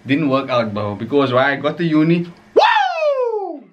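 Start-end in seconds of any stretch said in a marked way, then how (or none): 2.5-3.6 high excited whoop; party whoop. Retold or imitated. Celebrating getting to uni.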